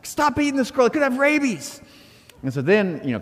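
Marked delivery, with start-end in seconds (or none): 0.0-1.8 high-pitched, frantic mock yell; yelling at dog. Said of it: "I start yelling at Katie".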